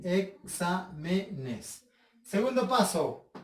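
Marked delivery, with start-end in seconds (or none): 0.0-1.8 "exámenes" slowly as e-xá-me-nes; short gap after each syllable; split into syllables. Shown as a demonstration. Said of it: "Sería así".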